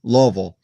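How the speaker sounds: "Louisville" in two syllables, LOH-vul; local pronunciation. Shown as a demonstration. Demonstrating for visitors, local pronunciation. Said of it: "you say Louisville".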